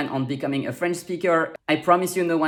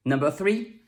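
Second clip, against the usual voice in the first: ordinary delivery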